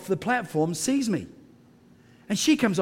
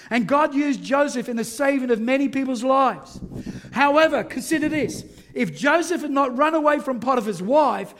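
louder, higher sermon delivery; full-force preaching; 7 semitones higher, 3 dB louder than usual. Acted. Pastor preaching to the church about Joseph, full force. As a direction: preach it out to the congregation.

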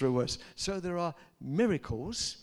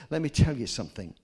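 ordinary delivery

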